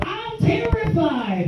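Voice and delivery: high, slow terrified blurt; high, slow panicked cry of terror. Retold or imitated. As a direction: blurt out your fear on the drop. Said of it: "And I blurted out".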